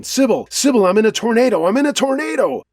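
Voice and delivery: high-pitched, urgent narrated scream; screaming into the phone. A narrator's reading. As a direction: shriek it in panic to a friend on the phone. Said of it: "kept screaming into the phone".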